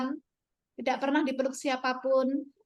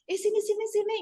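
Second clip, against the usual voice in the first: coaxing high call; 6 semitones higher, faster than usual. Retold or imitated. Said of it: "gitu, saya gini kan".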